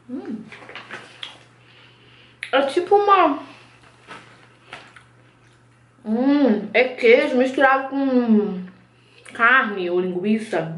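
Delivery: talking between bites; chewing while talking. Real, not acted. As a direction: mouth partly full of sausage. Seen 0: Bites thin sausage stick at 5.7s, cheeks show chewing throughout.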